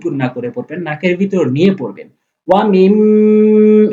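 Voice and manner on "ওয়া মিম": ওয়া মিম with the মিম held through the nose; nasal ghunna. Shown as a demonstration. Teacher demonstrates ghunna to students, nasal hum. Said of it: "এভাবে।".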